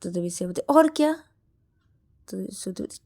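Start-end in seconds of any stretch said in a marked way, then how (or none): 0.6-1.1 loud high snap; impatient snap. Acted. Shreya snaps at Shruti, loud and impatient.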